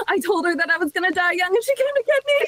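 high, half-laughing alarm; worked-up realisation. Retold or imitated. Dreamer realising auntie came to get her, alarmed. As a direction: blurt it out in a high mock panic.

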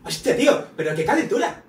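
high, loud Castilian exclaiming; Spaniard accent. Acted. Taking a turn at Spanish imitations, high Castilian accent. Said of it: "imitación en español".

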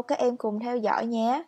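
ordinary talk, nothing marked

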